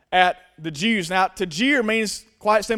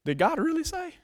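softer, high questioning lilt; casting doubt; 7 semitones higher, 8 dB quieter than usual. Retold or imitated. The enemy to Eve in the garden, insinuating doubt. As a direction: slyly plant a doubt with a question. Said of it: "The enemy loves to talk and cast doubts".

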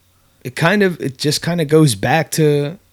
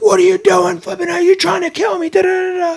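panicked breathless yell. Retold or imitated. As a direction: scream it as if you just came up gasping for air. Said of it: "he's all out of breath".

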